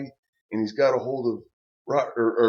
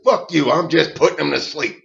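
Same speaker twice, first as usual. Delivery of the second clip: louder, brushing off; loud dismissive retort; 4 semitones higher, 9 dB louder than usual. Retold or imitated. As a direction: bark back mid-chokehold. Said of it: "Bruce said".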